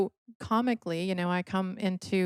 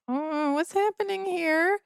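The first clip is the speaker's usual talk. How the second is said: put-on confused young self; 10 semitones higher than usual. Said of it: "I'm like".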